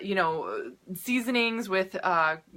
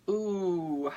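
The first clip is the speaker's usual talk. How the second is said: ordinary talk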